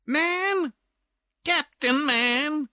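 high-pitched little lady butting in; high, excited feminine squeal; screamed interruption. Acted. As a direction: squeal it, eager to butt into the gossip. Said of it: "Here screamed some little feminine interloper".

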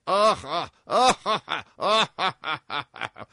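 loud, high burst of "ah-ah" laughter, dying away; acted chuckling. A narrator's reading. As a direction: laugh on, heedless of everyone. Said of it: "chuckled Tarpaulin".

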